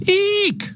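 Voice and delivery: very high squealed eek; mock scream. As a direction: squeal as if spotting a mouse. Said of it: "makes a lot of people scream".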